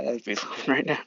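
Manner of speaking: ordinary delivery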